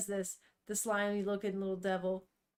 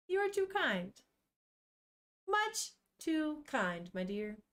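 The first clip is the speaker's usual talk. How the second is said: mock gracious; 3 semitones higher than usual. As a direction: play a gracious lady. Thanking a donor playfully.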